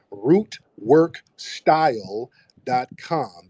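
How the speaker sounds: Root work style dot com, each word slow and spaced; slow spelled-out URL. Shown as a demonstration. Plugging the website to viewers, slow and deliberate. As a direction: read the web address slowly so viewers catch it. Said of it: "dot, no".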